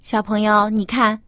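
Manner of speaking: usual speaking voice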